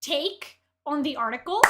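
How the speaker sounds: usual speaking voice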